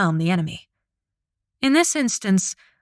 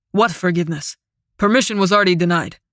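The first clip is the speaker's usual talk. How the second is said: angry; faster than usual. Acted. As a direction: snap it back quickly. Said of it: "Mihail sounded angry".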